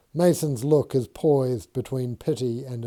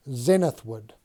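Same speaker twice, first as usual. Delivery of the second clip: ordinary delivery, nothing marked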